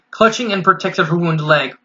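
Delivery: ordinary delivery, nothing marked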